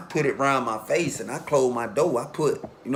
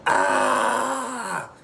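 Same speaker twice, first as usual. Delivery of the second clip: short high mock scream of pain; retold scream; 7 semitones higher than usual. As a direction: imitate the victim's scream, high and sharp.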